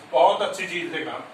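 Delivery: ordinary delivery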